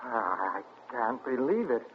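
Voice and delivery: low, soft, stunned murmur; barely able to take it in; stunned disbelief. Acted. Stunned by wife's secret past.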